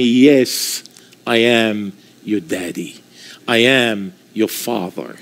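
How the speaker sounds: voice of God. Retold or imitated. God answering the mocking young man, low and soft. God speaking to him in room.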